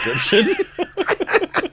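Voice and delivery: slow, laughing through the words. Real, not acted. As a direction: hold back laughter and drag it.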